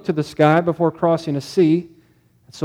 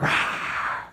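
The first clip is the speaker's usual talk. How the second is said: mock mouse roar; 10 dB quieter than usual.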